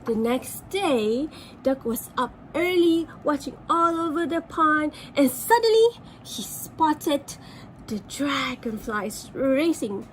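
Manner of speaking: ordinary delivery, nothing marked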